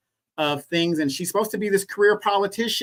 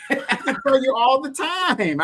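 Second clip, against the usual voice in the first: laughing; 8 semitones higher, slower than usual. Real, not acted. Laughing reply to Donovan's question.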